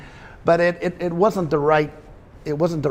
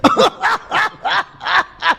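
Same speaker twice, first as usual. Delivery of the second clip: high dummy cackle; 5 dB louder than usual. Acted. Dummy laughs.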